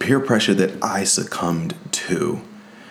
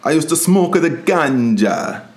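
put-on Jamaican accent; 8 semitones higher than usual. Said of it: "Stop with the Jamaican accent".

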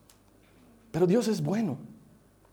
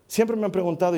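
ordinary talk, nothing marked

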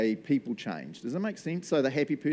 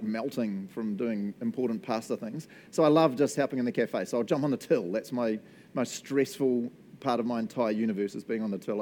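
normal talking voice

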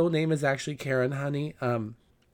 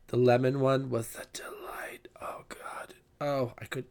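whispered delight; 4 dB quieter than usual. Real, not acted. Swooning over the lemon pie.

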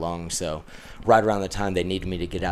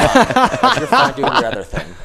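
laughing; 7 dB louder than usual. Real, not acted. Teasing through laughter.

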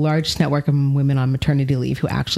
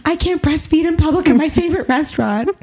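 much higher, louder, hammed-up indignant voice; mock entitled outrage; 12 semitones higher, 3 dB louder than usual. Mother retelling her restaurant outrage, high mock whine. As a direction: play a spoiled diner squealing, half laughing. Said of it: "I was like".

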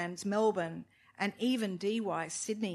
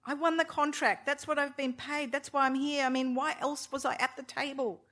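higher, protesting; indignant protest; 6 semitones higher than usual. A narrator's reading. Husband protesting to wife he won the contract, indignant. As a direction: insist you earned it.

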